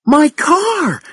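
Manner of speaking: high-pitched cry of alarm; narrated scream, very high, not full volume; shocked, shrieking exclamation. A narrator's reading. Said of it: "she screamed".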